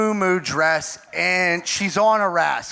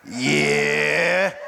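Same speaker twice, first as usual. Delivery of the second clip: low, drawn-out creepy reply; Crypt Keeper; 4 semitones lower than usual. Retold or imitated. Woman on a scooter answering the yell, creepy voice. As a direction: creak it out like a horror host. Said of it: "turns around like the Crypt Keeper".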